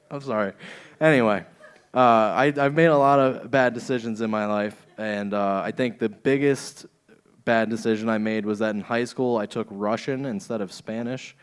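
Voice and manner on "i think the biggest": usual speaking voice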